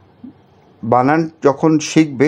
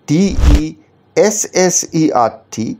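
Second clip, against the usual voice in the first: ডিইএসএসইআরটি spelled very slowly and a bit higher, the added এস drawn out; slow spelling with double S; 4 semitones higher, much slower than usual. Shown as a demonstration. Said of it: "যদি আরেকটা এস যোগ করে দেওয়া হয়".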